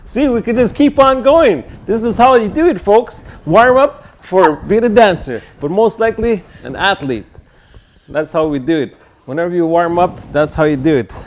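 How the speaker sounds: high and loud hype counting; pumped-up athlete. Acted. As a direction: count reps like an eager gym coach. Playing optimistic athlete, counting reps.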